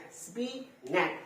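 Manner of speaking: "স্পিনাচ" slowly in two separate beats; split into syllables. Shown as a demonstration. Teacher counting syllables for pupils, "স্পিনাচ" syllable by syllable. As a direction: break "স্পিনাচ" into spi, then nak. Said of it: "দুটো syllable আছে".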